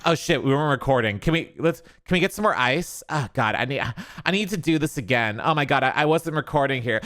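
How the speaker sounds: fast, flustered mock panic; frantic mock panic. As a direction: rush it like a flustered fake mom. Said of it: "And action".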